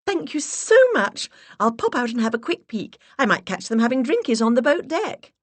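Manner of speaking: delighted, high and loud; delighted put-on gush. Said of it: "I clapped my hands in delight".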